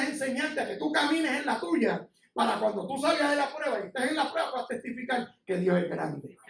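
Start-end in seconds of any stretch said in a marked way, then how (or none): none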